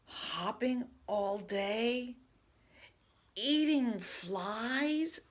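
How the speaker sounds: slow, loud, fretful imagining; worried Gerald. Acted. Gerald fearing he too becomes a frog, slow loud worry. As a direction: dread each frog image, drawn out.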